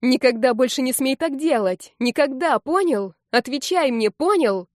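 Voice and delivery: high, loud, heated demand; unable to calm down. A narrator's reading. Heroine demanding he never do it again, angry and agitated. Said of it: "Не могла успокоиться я".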